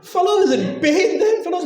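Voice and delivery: high, amused telling through laughter; laughing. Real, not acted. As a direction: laugh as you tell it. Laughing while describing a fall.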